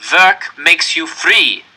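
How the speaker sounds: loud, very slow proclamation; cold officer. A narrator's reading. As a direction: announce the slogan to prisoners. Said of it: "the Nazi told us".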